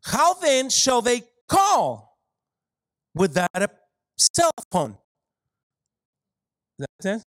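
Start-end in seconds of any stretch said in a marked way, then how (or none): 0.0-2.2 higher and slow, leaning on call; emphatic reading. Acted. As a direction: read it out slow with weight on call. Preacher reads verse emphatically.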